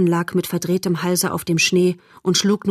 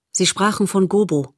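usual speaking voice

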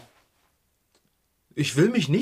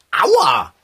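loud high ouch; pained yelp; 11 semitones higher, 7 dB louder than usual. Acted. Figarino yelps as his twin sits on him. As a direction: yelp as he gets sat on. Sat on, cries out.

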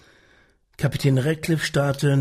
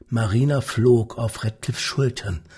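ordinary talk, nothing marked

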